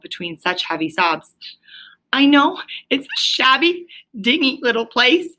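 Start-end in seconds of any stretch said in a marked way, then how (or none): 2.1-5.3 sobbing brokenly. A narrator's reading. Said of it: "he sobbed forth at last brokenly".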